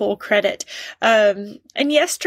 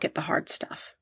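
whispered word; 5 semitones lower, 10 dB quieter than usual. Real, not acted. Hushed end of sentence.

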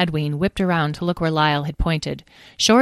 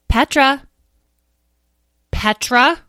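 raised, questioning name call; insistent call; 9 semitones higher, 7 dB louder, slower than usual. Acted. Edwin calling an inattentive sister, insistently. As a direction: press for someone's attention. Repeated calls to inattentive Petra.

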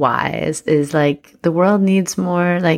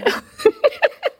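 high squealing laugh. Real, not acted. Laughing at partner.

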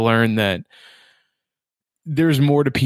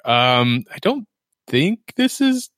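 high, slow, hesitant quote; doubtful audience; 10 semitones higher, slower than usual. Retold or imitated. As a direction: imitate an unsure viewer, pitched way up. Voicing a doubtful movie audience.

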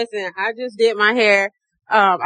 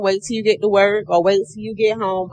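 normal talking voice

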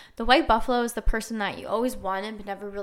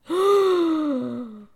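shocked gasp; 6 semitones higher, 3 dB louder than usual. Acted. Reacting to his rude reply.

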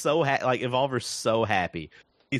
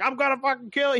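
much higher, hammed-up menace; mock robot threat; 12 semitones higher, faster than usual. Retelling robot villain's threat, high mock menace. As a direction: squeak out a cartoon threat. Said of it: "He's like".

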